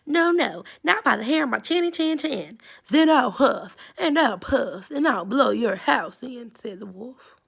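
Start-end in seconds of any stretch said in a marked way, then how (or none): 0.1-2.6 much higher, loud piglet voice; little pig squeaking no at the door; squeaky little pig. Acted. Pig refuses wolf again. 2.9-6.6 loud blustering wolf threat; storybook wolf bellowing at the door; big bad wolf. Wolf threatens second pig.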